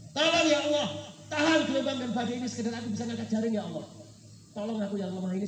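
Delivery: loud, high cry for help to God; desperate plea. Acted. Fisherman begging God to hold back the storm, desperate shouted plea. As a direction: cry out the prayer over the storm, then let it ease.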